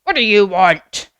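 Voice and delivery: loud, gruff doorkeeper demand; mock-grumpy challenge through a hatch. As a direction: bark it at unwanted callers. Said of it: "do a grumpy face".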